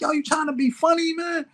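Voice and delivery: high, heated mock challenge; squaring up to the mentor; defensive. Young self getting defensive.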